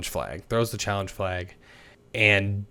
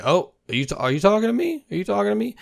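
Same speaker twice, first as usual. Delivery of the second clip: much higher, mock wide-eyed are you talking to me; mock innocent; 10 semitones higher, faster than usual. Anderson taunting his coach, mock innocent high voice. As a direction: play dumb in a high put-on voice. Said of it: "Anderson kinda looks around like".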